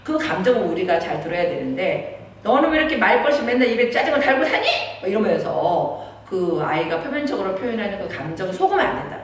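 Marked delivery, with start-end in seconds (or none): 2.4-4.7 louder, higher mock scolding with a laugh; scolding mother. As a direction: nag your daughter, hammed-up. (딸을 야단치는 엄마, 과장된 잔소리)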